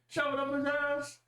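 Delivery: high, strained in-character holler; mock yell. Game master voicing a character who walks over, mock yelling. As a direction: call it out high like a heckler. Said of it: "you see Maori start walking over here".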